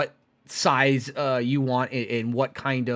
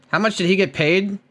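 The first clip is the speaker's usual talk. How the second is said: usual speaking voice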